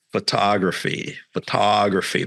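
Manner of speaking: "photography" slowly, with a stretched "TAW"; word pulled out. Retold or imitated. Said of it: "pull it out".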